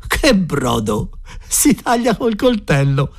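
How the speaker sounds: high-pitched, drawn-out exclamation; exclaiming. A narrator's reading. Scullery boy exclaims over the dishwater broth, exclaiming. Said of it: "lo sguattero esclamò".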